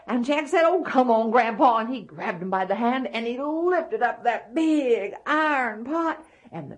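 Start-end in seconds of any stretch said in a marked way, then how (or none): 0.6-1.8 high, loud plea to hurry; boy's impatient raised call; exasperated urging. Acted. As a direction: hustle deaf Grandpa along loudly. Boy exasperated with deaf grandpa.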